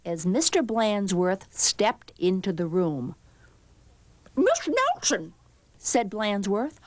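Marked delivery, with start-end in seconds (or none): 4.4-5.3 high, odd principal voice. A narrator's reading. Principal addressing the supposed teacher, official. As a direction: call her in a put-on official voice.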